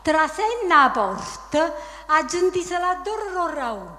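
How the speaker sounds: reciting verse in Neapolitan dialect; Neapolitan dialect poem. Acted. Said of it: "Dillo, dai, alza così".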